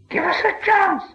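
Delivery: whiny wheedling to his precious; Gollum voice. A narrator's reading. Gollum begs for time.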